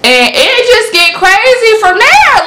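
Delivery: high, drawn-out excited exclamation; excited hype. Real, not acted. Reviewer hyping the book's wild turn to viewers, excited. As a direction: stretch it out high, hyping the madness.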